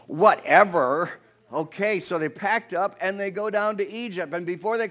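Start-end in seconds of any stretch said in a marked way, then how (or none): 0.1-1.1 loud, drawn-out "whatever", rising at the end; with attitude. Shown as a demonstration. Preacher mimicking Sarah's reply, exaggerated attitude. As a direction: stretch it out loud and sassy. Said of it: "Say it with attitude".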